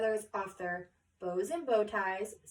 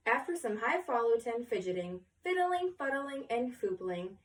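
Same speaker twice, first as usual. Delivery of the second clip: ordinary delivery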